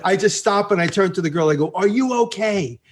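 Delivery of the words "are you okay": high, retold check-in; sudden concern. Rider turning to the girl he hit, sudden concern. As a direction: break off a rant to check on someone. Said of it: "in the middle of screaming, I just stop".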